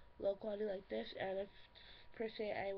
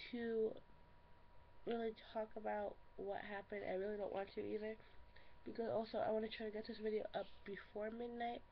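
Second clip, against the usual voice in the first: ordinary talk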